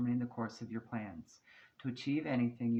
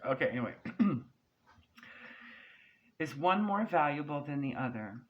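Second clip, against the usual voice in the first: ordinary talk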